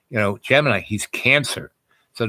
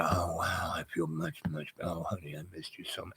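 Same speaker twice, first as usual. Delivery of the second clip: much softer, lower, drifting and dazed; dreamy murmur; 6 semitones lower, 16 dB quieter than usual. Retold or imitated. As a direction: murmur it blissed-out and hushed. Wife relaxing while smoking.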